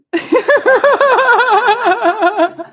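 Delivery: loud burst of laughter; big high laugh. Real, not acted. Teacher laughing loudly at her own anger.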